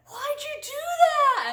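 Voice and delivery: high, slow, loud disbelief; shocked question. Retold or imitated. Turning to her friend after the kick, stunned and high.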